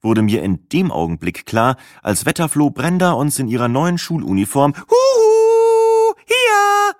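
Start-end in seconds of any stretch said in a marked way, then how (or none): 4.8-7.0 high sing-song trill across the room; trilled call. A narrator's reading. Brenda calls from the group table, high trilling. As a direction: trill it out brightly like a bird. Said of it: "zuträllerte".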